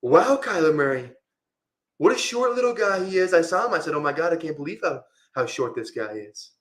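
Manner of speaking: amateur presidential impression, starting high and tapering down; Donald Trump. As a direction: marvel in a Trump voice, falling at the end. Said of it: "I was doing Trump".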